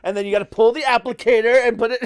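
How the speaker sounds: high, loud, laughing delivery; loud and high, dissolving into laughs; cracking up. Real, not acted. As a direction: barely get it out through laughter. Cracking up at own stick-figure joke.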